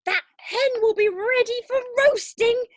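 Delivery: high, loud jeer; old woman crowing at the boy; gleeful shout. Acted. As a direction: shout it with mocking glee. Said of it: "Grandma shouted".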